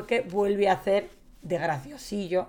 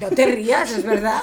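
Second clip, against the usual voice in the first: laughing protest; 3 semitones higher, 7 dB louder than usual. Real, not acted. Insists while laughing, louder.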